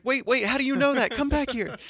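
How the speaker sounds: high-pitched, urgent calling after someone; mock urgent call. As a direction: call out high, as if stopping a passerby. Acts David calling after passerby.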